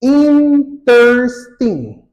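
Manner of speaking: "Interesting" slowly broken into in, ters, ting; syllable by syllable. Shown as a demonstration. Teacher showing learners a longer adjective, slow syllable-by-syllable demonstration. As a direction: stretch "Interesting" out in separate, emphatic beats. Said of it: "two syllables".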